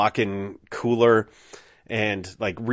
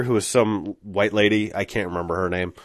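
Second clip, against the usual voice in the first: ordinary talk, nothing marked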